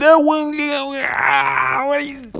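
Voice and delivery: put-on high, loud outraged child shout; child's angry shout. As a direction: shriek it like a furious kid. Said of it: "He said".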